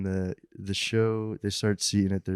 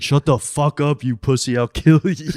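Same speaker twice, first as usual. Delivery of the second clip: raised, louder mock scream with a laugh behind it; retold dad screaming; 5 semitones higher, 5 dB louder than usual. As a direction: imitate an angry dad yelling threats. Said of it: "he fucking started screaming at him".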